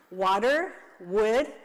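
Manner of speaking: ordinary delivery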